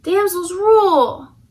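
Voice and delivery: loud, high, slow victory cry; mock triumphant damsel; triumphant cheer. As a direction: gloat proudly.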